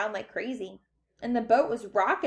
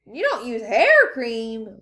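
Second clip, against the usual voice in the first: loud, slow, drawn-out protest; incredulous objection; 8 dB louder, slower than usual. A narrator's reading. Mike objecting to the door sign, incredulous. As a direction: stretch out the disbelief.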